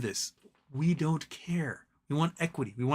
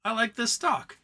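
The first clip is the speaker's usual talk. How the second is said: mock proud retail buyer; 9 semitones higher than usual. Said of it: "It's just like saying".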